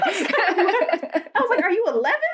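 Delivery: real laughter while mocking him; laughing disbelief. Real, not acted.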